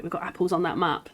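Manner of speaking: ordinary talk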